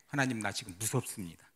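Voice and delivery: a hushed, understated tearful prayer of fear; tearful confession. Retold or imitated. Pastor retelling his prayer over his wife, quietly tearful. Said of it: "찔찔나면서 이런 고백을 해요".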